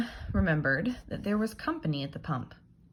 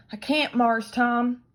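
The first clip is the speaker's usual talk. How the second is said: put-on loud, higher, slow boyish refusal; Jim refusing; 7 semitones higher, 7 dB louder, slower than usual. Jim refusing Tom, slow worried boy voice. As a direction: say no, worried and firm.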